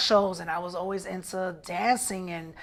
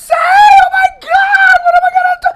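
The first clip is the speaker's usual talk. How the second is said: high, loud, frantic wailing; dramatic panic; 15 dB louder, much faster than usual. Retold or imitated. Teen telling his mom, panicking over what family will say, frantic high wailing. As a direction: panic out loud like the world is ending. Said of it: "Oh God, so dramatic".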